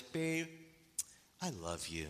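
a lightly imitated tender whisper-soft wife; softly imitating a wife's sweet words; tender loving words. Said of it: "with this beautiful smile of hers".

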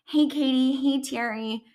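high, bright mock-sweet hello; fake friendly greeting. [name] baiting Katie and her mother, high mock-sweet greeting. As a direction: greet them sweetly to bait them. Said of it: "deliberately approaching Katie, trying to get a rise".

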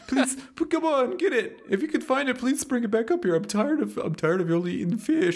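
high voice, pleading through laughter; high laughing plea. Acted. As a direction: beg in a high giggly voice. Collar begs, laughing.

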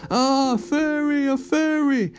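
high, slow scared cry; frightened troll. A narrator's reading. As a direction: shrink back in fear.

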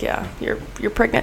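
hushed whisper announcing the result; whispered verdict. Pretend. The pregnancy test telling her the news, whispered. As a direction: breathe it like a secret verdict.

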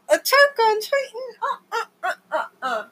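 high, squealing mock cry of disgust; mock wailing. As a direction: wail like it physically hurts to think about it. Disgusted outburst about Attack on Titan.

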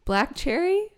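high and emphatic disgust. Real, not acted. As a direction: insist with rising disgust.

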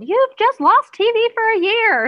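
high, loud mock scream; screaming parent. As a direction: scream an over-the-top punishment at a child. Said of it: "scream at our child".

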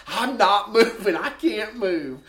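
high, loud imitated refusal, slowing down; mock helpless refusal. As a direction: imitate someone stuck, dragging out the end. Retold helpless refusal amid laughter.

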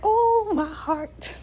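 high, slow melodramatic swoon; hammed-up, overcome with emotion; dramatic. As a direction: clutch your heart and swoon. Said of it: "I'm so dramatic".

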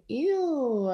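long, high-pitched ew of disgust. Retold or imitated. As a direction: recoil with a drawn-out, high ew. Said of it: "I was thinking".

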